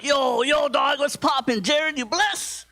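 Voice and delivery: high, stretched-out mock thug greeting; imitating thug friend. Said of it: "my thug friends".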